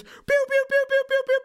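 very high, rapid-fire pew noises; imitated pew-pew gunfire. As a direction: play fighter-jet shots with your voice. Imitates jet weapons in hype pitch.

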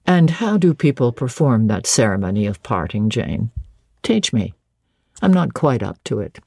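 low-pitched voice of the master; Rochester. Acted.

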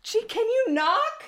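high, half-laughing protest; rattled exclamation. Real, not acted. Telling her husband he should knock, high rattled laughing protest. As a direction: still shaken, scolding through laughs. Scolding husband after fright.

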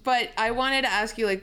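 ordinary talk, nothing marked

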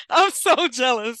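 excited. Real, not acted. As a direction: squeal it out, playfully jealous. Reacting to concert news.

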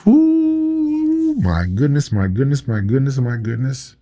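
high swooping ooh, then softening my goodnesses; preacher shaking his head at the crowd; dismayed exclamation. Real, not acted. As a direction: groan it out in disbelief.

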